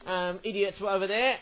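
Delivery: ordinary talk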